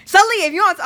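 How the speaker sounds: loud, high mock-mama warning; imitating mom raising her voice. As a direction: cut in loudly like a fed-up mom.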